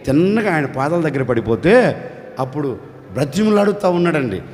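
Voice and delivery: ordinary delivery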